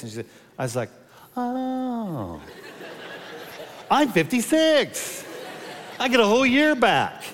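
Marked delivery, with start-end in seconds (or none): 1.4-7.2 high, laughing joy at good news; thrilled, laughing; laughing delight. Retold or imitated. Pastor realizing he is a year younger, high laughing delight. Joyful at getting year back.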